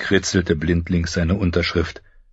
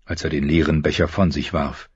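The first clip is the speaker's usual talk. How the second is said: ordinary talk, nothing marked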